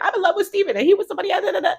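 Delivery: high-pitched, rapid-fire mock outburst; hammed-up, squeaky-high telling-off at top speed; mock rant. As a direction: rattle off an imitation tirade, fast and high. Acted cussing-out in retold story.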